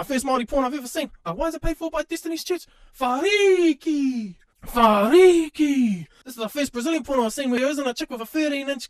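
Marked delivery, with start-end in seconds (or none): 3.0-6.1 slow, stretched call; drawn-out call. Acted. Calling out the name, slow and drawn out. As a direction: drag the name out long.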